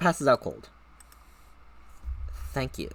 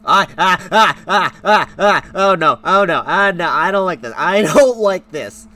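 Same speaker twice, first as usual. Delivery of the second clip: loud, high panicked cries with nervous laughter; shrieking ah ah, then laughing through the fear; scared yelping; 8 semitones higher, 13 dB louder, slower than usual. Real, not acted. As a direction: yelp in real fright at the screen.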